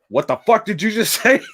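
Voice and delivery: high-pitched indignant dad, breaking into a laugh; mock outrage. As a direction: imitate an incensed father snapping at his son. Acted angry father challenging his son.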